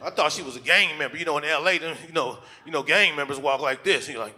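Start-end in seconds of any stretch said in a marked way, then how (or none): none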